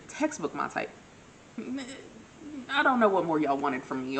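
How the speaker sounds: usual speaking voice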